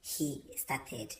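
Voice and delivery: ordinary delivery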